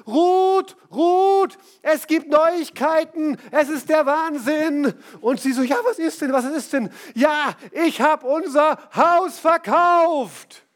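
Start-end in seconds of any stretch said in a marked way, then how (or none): 0.1-4.9 viel höher und lauter, langsam gerufen; ruft von Weitem. Retold or imitated. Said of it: "wie er schnauft und keucht und ruft". 5.6-6.9 neugierig gespannt. Retold or imitated. Frau reagiert auf Rufen. 7.1-10.7 viel höher und lauter, langsam herausgerufen; freudiger Ausruf. Retold or imitated. Mann verkündet Neuigkeit stolz.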